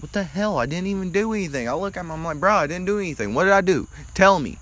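high, outraged disbelief; high-pitched retold protest; indignant protest. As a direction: act wrongly accused, pleading. Protesting being pulled from class.

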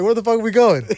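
high, loud incredulous yelp. Retold or imitated. Asking his drunk friend where they're going, high and loud in disbelief. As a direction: laugh it out in disbelief.

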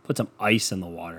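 ordinary talk

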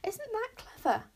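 high-pitched proud delight; delighted, lifted question. A narrator's reading. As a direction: show off your clever pet. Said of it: "said Wish proudly".

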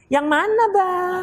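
lightly voiced, a touch sweet and lilting; coy female reply. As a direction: play the girl answering a little too sweetly. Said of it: "Nggak boleh gitu". (nada perempuan yang sedikit mendayu)

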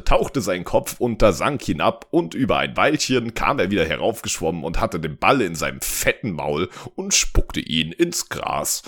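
ordinary delivery

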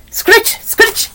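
high, brisk scritch sound effect; scratching noise. A narrator's reading. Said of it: "heard the noise of the fireplace".

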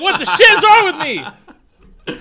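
high, loud, drawn-out mock alarm; exasperated outburst. Catching himself mid-accent with roommate, exasperated mock shout. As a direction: cry it out in laughing disbelief at yourself.

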